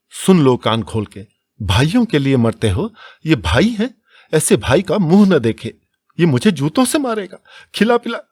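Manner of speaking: high, loud shrieking rage; furious shout. A narrator's reading.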